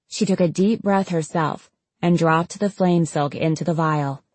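plain reading voice

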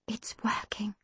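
hushed, unvoiced whisper; barely-there whisper of hope. A narrator's reading. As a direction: whisper it into the stillness. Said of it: "Dad whispered into the stillness".

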